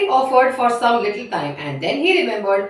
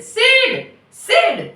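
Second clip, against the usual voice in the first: high, aggravated call; angry and irritated; 4 dB louder than usual. A narrator's reading. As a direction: call out sharply to rouse a sleeper. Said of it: "he is really angry and irritated".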